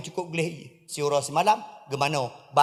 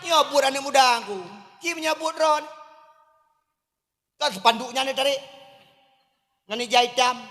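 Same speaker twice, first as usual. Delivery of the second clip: fervent preaching; 7 semitones higher than usual. Acted. Preacher appealing to the police chief, full-force preaching. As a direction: plead for the youth from the pulpit.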